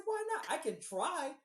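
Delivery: squeaky, wounded protest, why not; indignant surprise. Retold or imitated. Player protesting to the DM, squeaky indignant why not.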